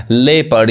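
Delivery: लेपर्ड said as "lep-erd"; लेपर्ड with silent o; correct pronunciation. Shown as a demonstration. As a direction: say लेपर्ड in two syllables, no o. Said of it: "वो नहीं पढ़ाएगा".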